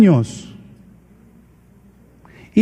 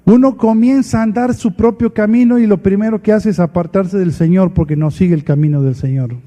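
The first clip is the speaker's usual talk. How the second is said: ordinary talk, nothing marked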